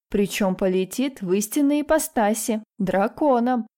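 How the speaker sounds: ordinary delivery, nothing marked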